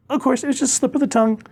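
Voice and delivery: very high, rushed excuse; flustered backpedal. Acted. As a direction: squeak out a hasty correction. Partner hastily backpedals.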